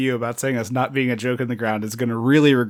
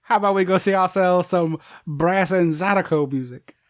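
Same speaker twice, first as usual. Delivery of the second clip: hammed-up Southern invitation; mock New Orleans accent; 5 semitones higher than usual. Heroine inviting Johnny to hear music, mock New Orleans accent.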